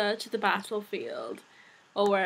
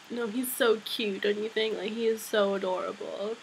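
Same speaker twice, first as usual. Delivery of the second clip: ordinary delivery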